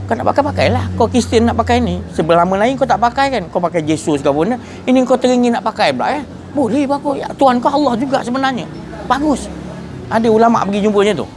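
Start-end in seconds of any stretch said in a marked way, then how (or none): 0.1-9.5 teasing permission. Retold or imitated.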